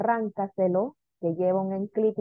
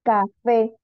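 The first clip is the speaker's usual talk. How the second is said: Café in two loud beats, FÉ stressed; teacher sounding out Café, stressed at the end; stress on last syllable; 5 dB louder than usual. Shown as a demonstration. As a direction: punch the FÉ of Café. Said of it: "Última sílaba".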